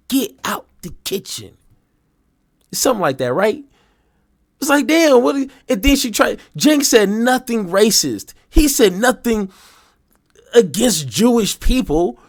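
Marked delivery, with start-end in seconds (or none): none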